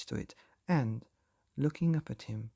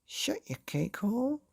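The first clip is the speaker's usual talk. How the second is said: sharp high snap; girl snapping at her mother; 8 semitones higher than usual. A narrator's reading. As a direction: bite his head off. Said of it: "snapped the girl".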